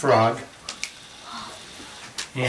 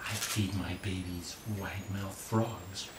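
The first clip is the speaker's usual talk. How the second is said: quiet snake; 3 semitones lower, 11 dB quieter than usual. A narrator's reading. Snake's menacing answer.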